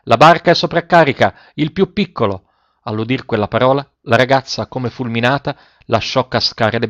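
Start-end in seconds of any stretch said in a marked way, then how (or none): 0.0-2.5 high, urgent warning call; storyteller's sailors calling out; sailors' shout. A narrator's reading. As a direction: warn them in a raised, narrated cry. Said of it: "gridarono i marinai".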